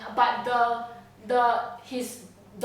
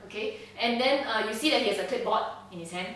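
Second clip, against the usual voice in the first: ordinary talk, nothing marked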